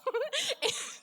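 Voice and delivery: high, real cackle of laughter; high burst of laughter. Real, not acted. Cracking up.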